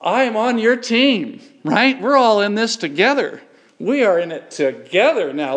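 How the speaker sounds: rally the congregation like a coach; rallying preaching. Acted. Rallying the church as one team.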